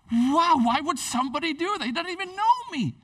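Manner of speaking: high, loud amazed exclamation; overwhelmed by kindness, gasp it out; amazed wow. Retold or imitated. Newcomer marvelling that Jesus paid for them, high amazed voice. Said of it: "You're just like".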